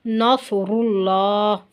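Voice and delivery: नसरुल्लाह with heavy र and thick ल, slowly; slow tajweed recitation; heavy ra and lam. Shown as a demonstration. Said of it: "लाम को भी लाजमी मोटा करेंगे".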